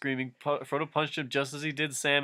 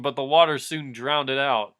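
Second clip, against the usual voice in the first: ordinary delivery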